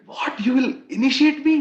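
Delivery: high, loud disbelieving cry; girl stunned that the sage will initiate her; astonished. Retold or imitated. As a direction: gasp out the question in amazement.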